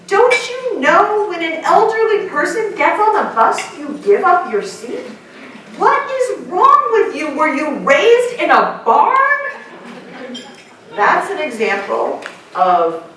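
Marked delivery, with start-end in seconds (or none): 0.0-9.4 indignant scolding, rising high; outraged lecture to a teen; mom lecture. Retold or imitated. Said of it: "will go over to that teenager and say".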